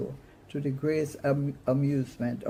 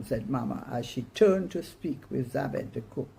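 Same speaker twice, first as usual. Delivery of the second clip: plain reading voice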